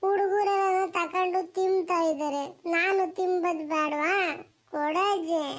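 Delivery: put-on drawn-out, pleading child's whine; wheedling little grandchild; whining child. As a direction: beg grandma slowly, stretching out the words. Grandchild begs grandmother for money.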